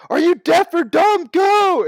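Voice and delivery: loud, high acted yell, slowed for force; exasperated shout. Dunk to villagers who won't leave, exasperated shouting. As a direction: bellow it at people who won't budge.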